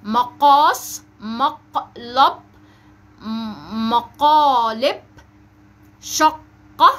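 slow "مقاس، مقلب، مقالب، شقة", loud on the q; drawn-out model with a heavy q in "مقلب، مقالب"; emphatic middle qaf. Shown as a demonstration. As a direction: split the word and punch the q, as in "مقاس، شقة". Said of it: "نطق كلمات بها حرف القاف في النص".

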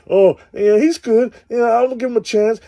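high put-on girly voice gushing about a new guy; mock naive girl. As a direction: go up high and gush like a smitten girl. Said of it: "But these bitches, man".

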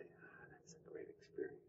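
breathy whisper of a strange opinion; whispered aside. Pretend. Teacher whispering the odd man's answer about war. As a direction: whisper it like a secret. Rare guy praising war.

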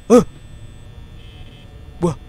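ordinary talk

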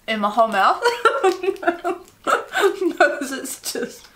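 laughing through chewy candy; giggling mid chew; laughing with mouth full. Real, not acted. Laughing while chewing taffy.

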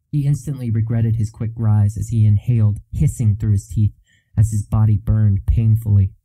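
ordinary delivery, nothing marked

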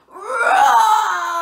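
big, high, full-force lion roar; loudest roar. Shown as a demonstration. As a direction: lead the children in a huge roar. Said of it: "the loudest roar you've ever done".

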